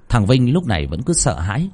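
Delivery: plain reading voice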